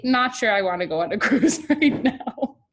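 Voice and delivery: talking through a laugh; amused, laughing admission. Real, not acted. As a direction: break into a laugh mid-sentence. Jokes about her own wish.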